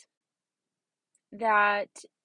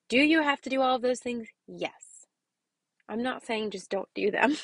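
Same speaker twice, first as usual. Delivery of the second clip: normal talking voice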